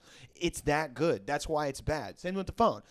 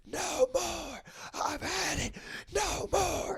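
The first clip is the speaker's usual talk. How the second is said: high-pitched imitation of a man screaming; mock screaming; 4 dB quieter, much slower than usual. Retelling a man in a Walmart smashing beer, mock screaming. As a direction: scream it high without real volume. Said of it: "he's screaming".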